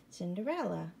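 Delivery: ordinary delivery, nothing marked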